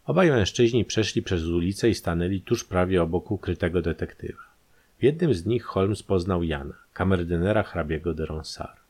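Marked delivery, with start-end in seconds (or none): none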